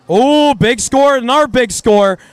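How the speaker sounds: big excited play-by-play call; excited shout. Real, not acted. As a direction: call the big score with a burst.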